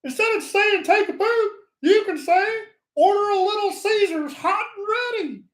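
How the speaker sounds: high squeaky toilet paper puppet voice. Retold or imitated. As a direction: put on a high cartoon puppet voice. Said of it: "he kinda talks like this".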